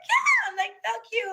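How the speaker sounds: high, loud mock scream; retold yelling. Reenacting an argument for the content, high-pitched yelling. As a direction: yell it back in a high pitch. Retells yelling back on camera.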